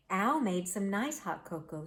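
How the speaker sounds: plain reading voice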